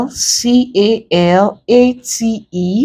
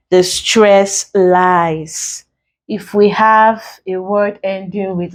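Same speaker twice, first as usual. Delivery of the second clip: ordinary talk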